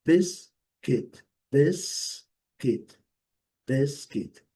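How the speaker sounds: "Biscuit" broken slowly in two, first syllable strong; slow, separated syllables in "Biscuit", silent U; syllable-by-syllable stress. Shown as a demonstration. Teacher drilling biscuit slowly, strong first syllable. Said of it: "sílaba forte".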